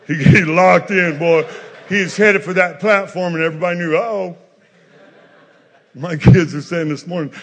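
loud preaching with laughs in it; full-force preaching. Acted. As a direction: preach it loud, grinning at the story. Preacher surges back to full force.

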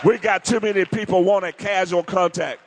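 preaching shout. Real, not acted. Preacher rebuking the congregation, full shout. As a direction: call it out to the whole church. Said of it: "preacher shouting the point".

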